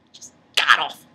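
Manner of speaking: whispered "god-awful"; whispered repeat. Real, not acted. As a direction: breathe "Just god-awful" with no voice. Repeating the insult.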